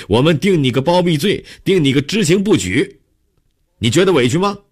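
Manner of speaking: 怒斥. A narrator's reading. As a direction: build to a high, forceful dressing-down. Captain berates suspect, silencing her. (越说越高，厉声斥责)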